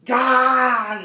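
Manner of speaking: sudden high loud yell; startled scream of god; shout. Acted. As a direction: explode with the word.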